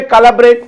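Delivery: "collaborate" as KA-la-brate; wrong hard C. Shown as a demonstration. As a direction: celebrate misread as "collaborate" with a k. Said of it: "Why can't we say".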